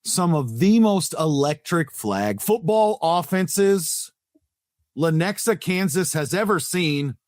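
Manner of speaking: grand, drawn-out host introduction; show announcer intro. Acted.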